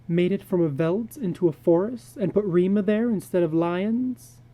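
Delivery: a lightly voiced anxious wife asking; wife questioning her husband in the dark; wife's voice.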